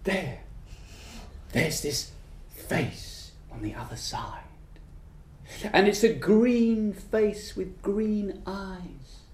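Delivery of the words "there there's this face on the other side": hushed unvoiced whisper. A narrator's reading. Storyteller revealing the face to listeners, whispering. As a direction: whisper it like a secret.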